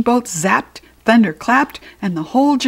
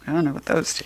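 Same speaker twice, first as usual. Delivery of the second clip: ordinary delivery, nothing marked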